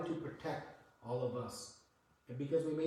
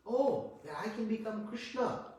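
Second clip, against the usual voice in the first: proud ego voice; 6 semitones higher than usual. Retold or imitated. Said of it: "the feeling in the living entity".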